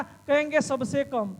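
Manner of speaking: ordinary talk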